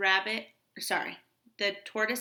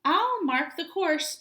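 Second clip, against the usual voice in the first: higher, louder bird squawk; squawking roadrunner; 6 semitones higher, 5 dB louder than usual. Acted. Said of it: "squawked Roadrunner".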